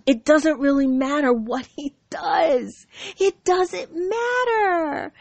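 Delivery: high, drawn-out dismissal; emphatic dismissal. Real, not acted. Brushing off whatever the ex does, emphatically dismissive. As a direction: stretch it out, rising high on the repeat. Gloating dismissal of her ex's interference.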